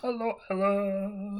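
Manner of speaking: high, drawn-out hello; playful stretched-out hello; singsong greeting. Pretend. Greeting a twelve-year-old listener.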